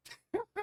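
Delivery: sudden high laugh; a quick amused laugh; burst of laughter. Real, not acted. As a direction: break out laughing at the slip. Reader laughs at own misreading.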